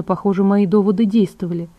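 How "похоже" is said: usual speaking voice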